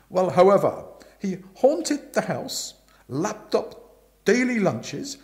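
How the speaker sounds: loud, forceful Aunt Dahlia voice; bossy aunt telling Bertie the gossip, loud and brisk; booming aunt. Acted.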